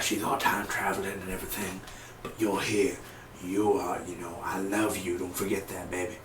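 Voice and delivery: soft, grieving father voice; hushed, halting murmur to a lost daughter; Lance. Acted. Reviewer playing a father mourning Laurel, soft grief. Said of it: "trying to do a Lance impression".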